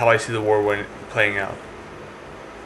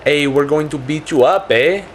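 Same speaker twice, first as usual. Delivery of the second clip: higher, lightly put-on Canadian taunt with a tagged "eh"; improvised Canadian; 3 semitones higher, slower than usual. Canadians threatening to beat up the French, improvised Canadian voice. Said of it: "I don't know how a Canadian talks, so I'm just gonna, like, improvise and put "a".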